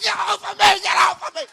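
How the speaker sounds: high, loud mock shout to be let go; brother's yell. Brother yelling at mom to get off him. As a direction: shout to throw her off. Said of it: "My brother like".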